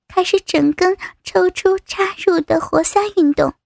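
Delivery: ordinary delivery, nothing marked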